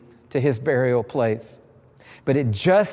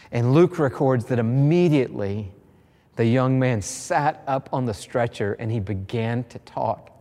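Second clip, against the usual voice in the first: usual speaking voice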